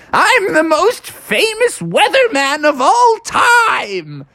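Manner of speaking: high, drawn-out boast; Punxsutawney Phil. Acted. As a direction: proclaim it grandly as a cartoon groundhog. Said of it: "I'm Punxsutawney Phil".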